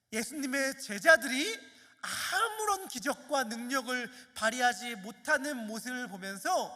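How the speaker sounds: normal talking voice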